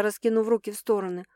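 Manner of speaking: plain reading voice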